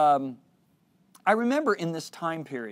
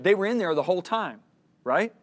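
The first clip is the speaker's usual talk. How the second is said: higher, louder insistence; emphatic point; 6 semitones higher, 8 dB louder than usual. Retold or imitated. God teaching the speaker about hidden impurities, emphatic point. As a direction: drive the point home, then check for agreement.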